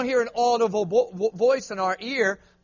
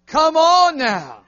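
1 hyped call; 5 semitones higher, 8 dB louder, much slower than usual. Real, not acted. Preacher urging the crowd.

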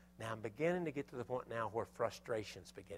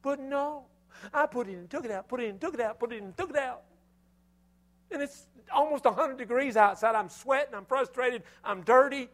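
high, loud exasperated rant; frustrated outburst; 12 semitones higher, 9 dB louder than usual. Real, not acted. Preacher reliving sweaty repair work, high and loud exasperation. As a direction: vent the heat and the frustration. Retelling his hot frustrated struggle.

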